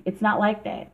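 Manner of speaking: ordinary talk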